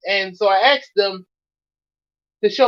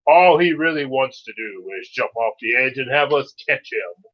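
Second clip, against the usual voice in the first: ordinary delivery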